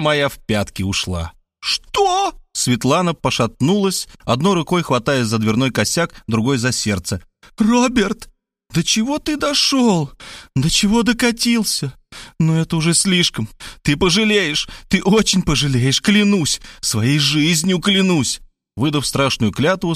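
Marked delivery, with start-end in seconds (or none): 1.6-2.3 very high shocked female cry. Acted. Woman shocked by reveal. 7.6-18.4 outraged woman. Acted. Woman swears revenge on Robert.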